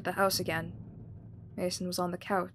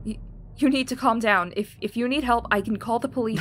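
raised anxious voice; 4 semitones higher, 8 dB louder than usual. Acted. Mother tries calming him.

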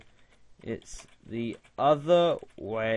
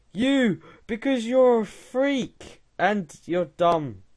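teasing taunt; 7 semitones higher than usual. Pretend. Taunts the chatbot.

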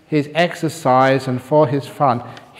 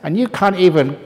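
a proud scholar scolding the monk; angry rant; 5 semitones higher, 5 dB louder than usual. Acted. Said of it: "the professor stood up and said".

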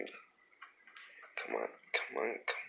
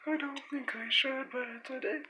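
tiny squeaky toy voice; 6 dB quieter than usual. Pretend.

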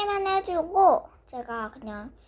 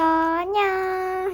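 drawn-out goodbye; 5 semitones higher, 4 dB louder, much slower than usual. Real, not acted. Drawn out farewell.

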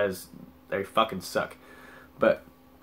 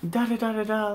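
high falsetto babble; mocking high-pitched filler; mock freaking out; 12 semitones higher than usual. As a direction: imitate her panicked rambling in nonsense syllables. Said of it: "She's, like, freaking out".

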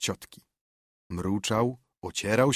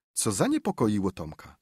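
usual speaking voice

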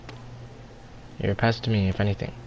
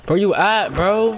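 yelling; 8 semitones higher, 5 dB louder than usual. Real, not acted. Yelling at teammate mid-game.

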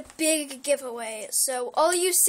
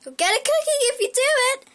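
excited squeal; 11 semitones higher, 10 dB louder, faster than usual. Real, not acted. Excited shouted sign-off.

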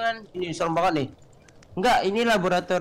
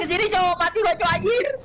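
high-pitched terrified cry; panicked shriek; 11 semitones higher than usual. Real, not acted. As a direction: shriek as you get caught. Monster catching him.

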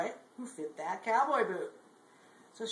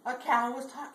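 usual speaking voice

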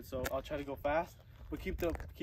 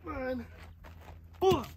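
straining; 9 semitones higher, 5 dB louder than usual. Real, not acted. Straining through reps.

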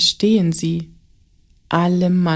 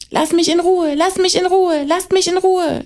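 much higher, louder desperate screams; screaming; 12 semitones higher, 4 dB louder than usual. A narrator's reading. Martin screams at the bullies to leave him alone. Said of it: "schreit Martin".

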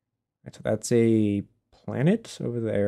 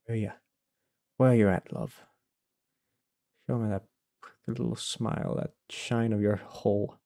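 mock giving-up; 6 dB quieter than usual. Streamer coaxing the hidden object to appear, soft mock giving-up. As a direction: sound half defeated, coaxing it softly out. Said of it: "talking like that again".

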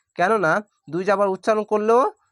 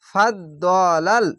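slow demo reading; much slower than usual. Shown as a demonstration. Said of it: "teacher reads the word slowly".

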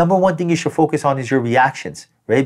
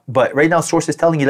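normal talking voice

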